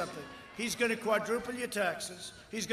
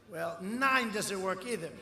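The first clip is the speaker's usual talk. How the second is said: ordinary talk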